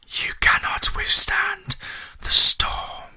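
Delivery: slow, menacing stage whisper; drawn-out ominous whisper. A narrator's reading. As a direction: whisper it slowly as a dark warning. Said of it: "Fate whispers to the warrior".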